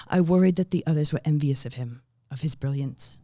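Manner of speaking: a hint of a solemn film voiceover; voiceover narration. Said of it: "This is voiceover".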